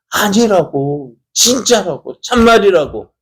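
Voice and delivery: high-pitched pleading insistence; acted girl insisting it's true; insistent protest. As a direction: protest each phrase, desperate to be believed. Girl insists she saw Peter.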